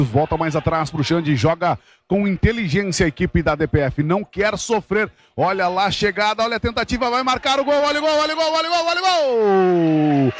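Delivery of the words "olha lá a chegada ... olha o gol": screaming goal call, rising pitch; explosive goal commentary. Real, not acted. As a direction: yell the goal with everything. Live goal call, scoring moment.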